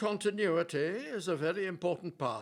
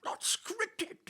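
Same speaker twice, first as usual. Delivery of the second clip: high, scandalised disbelief; theatrical outrage; aghast. Acted. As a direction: recoil at the very idea. Shakespeare hears there's no script.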